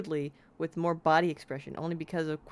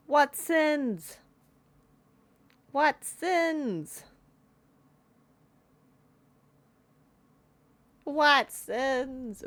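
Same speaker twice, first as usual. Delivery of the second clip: high, loud, hammed-up outrage; mock indignant; 9 semitones higher, 3 dB louder, much slower than usual. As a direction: scoff at the idea of sin. Mocking the game text.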